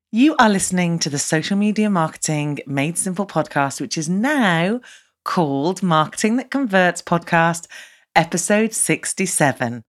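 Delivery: host reading her own show opening; podcast intro read. Shown as a demonstration. Host announcing the renamed podcast, mild announcer read. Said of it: "trying to do that intro with a different name".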